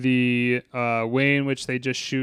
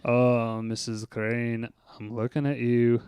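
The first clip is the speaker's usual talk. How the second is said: low, softer voice slipping into Scottish; accidental Scottish accent; 4 semitones lower, 6 dB quieter, slower than usual. Acted. Groundskeeper addressing Missus Crane, with an unintended Scottish accent. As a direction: drift into a slight Scottish accent by mistake. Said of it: "I'm doing a Scottish accent".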